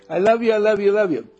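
loud, high gushing "I love you"; loving coo. Shown as a demonstration. Gushing love to a seed in the dirt, loud and high.

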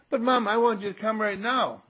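put-on high, loud child's complaint; acted whine to Mom; protesting little boy. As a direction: insist like an impatient kid. Voicing boy protesting to mother.

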